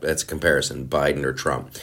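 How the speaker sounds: ordinary talk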